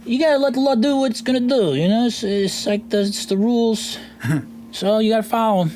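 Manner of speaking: high, rambling gnome voice; high-pitched, hesitant little merchant; Pimley. Acted. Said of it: "You see, Pimley says".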